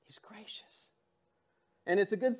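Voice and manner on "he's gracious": hushed whispered aside. Real, not acted. Pastor whispering to the congregation about grace. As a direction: breathe it almost silently.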